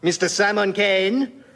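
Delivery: put-on slow, grand French accent; outrageous French accent. Voltaire introducing his English friend in a French accent. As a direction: announce the guest grandly, French style. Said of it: "in outrageous French accent".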